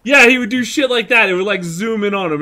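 normal talking voice

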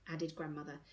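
plain reading voice

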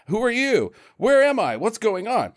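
high-pitched, baffled questioning; mock panicked confusion; acted bewilderment. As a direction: play the confused man, pitch shooting up. Acting out confused man waking.

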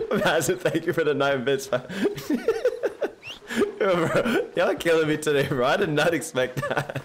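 thanking chat through laughter; giggling between words, tickled by chat; real laughter while reading a donation; laughing. Real, not acted.

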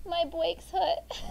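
put-on very slow, little-kid complaint through a laugh; toddler voice. Mother voicing her toddler saying his brakes hurt, slow. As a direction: play the toddler, dead serious but cute. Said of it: "he goes".

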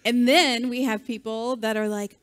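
normal talking voice

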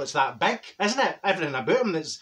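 usual speaking voice

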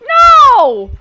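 loud, high cry of dismay; despairing no. Real, not acted. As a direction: yell it as the plan falls apart. Teammate pops the cipher.

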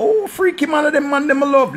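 high mock shout of a swooning girl. Mocking girls who brag about freaky men, high shout. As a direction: squeal it like a girl gushing over a freaky man. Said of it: "every girl here talk about".

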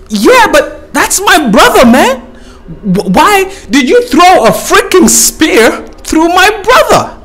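high, slow indignant gazelle voice; shrill up the grieving gazelle's anger; angry gazelle. Acted. Gazelle demands why Adam speared his brother, high indignant voice. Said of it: "The gazelle goes like".

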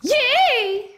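high, drawn-out squeal of glee; teasing cheer. Real, not acted. Girl cheers to annoy streamer, high teasing cheer.

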